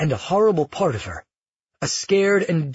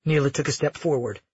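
ordinary delivery, nothing marked

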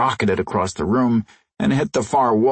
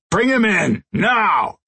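impatient command; 10 semitones higher, slower than usual. Acted. Boss repeats order impatiently.

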